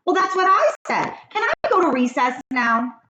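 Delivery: put-on high, eager kid voice; pleading little boy, fast and high; Joe's voice. A narrator's reading. Joe begs the teacher to let him go to recess, high eager kid voice.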